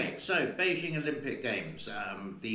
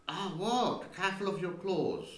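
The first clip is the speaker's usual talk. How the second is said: sudden higher yelp of surprise; startled by claws; 8 semitones higher than usual. Real, not acted. Owner reacts as her cat scratches her, surprised higher yelp. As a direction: jump as the claws dig in.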